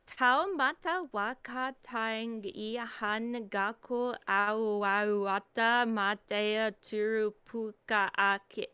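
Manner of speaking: slow, careful second try; slow careful reading. Shown as a demonstration. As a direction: sound out each syllable slowly. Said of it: "You're gonna make me start over?".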